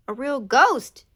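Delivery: loud, high frightened cry; alarmed, point and cry out. Acted. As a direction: Goose crying out in fright at the ghost. Goose panics at the ghost.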